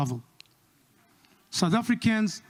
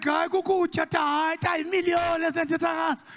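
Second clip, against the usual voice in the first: eager pleading; 9 semitones higher than usual. Retold or imitated. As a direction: beg to be let in on the millions. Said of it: "I'm like".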